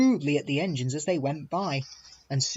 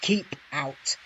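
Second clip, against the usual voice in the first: hostile; 5 semitones higher, 4 dB louder, much slower than usual. A narrator's reading. Engines bar Duck's way.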